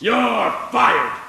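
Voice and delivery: loud, high angry bellow; furious shout. Acted. Banker firing the bank examiner, shouting in fury. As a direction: bark the firing at her.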